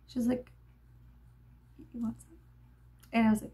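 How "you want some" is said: soft, offhand imitation; understated offer. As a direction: quietly toss the offer out. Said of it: "No, she wasn't like".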